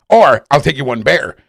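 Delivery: ordinary delivery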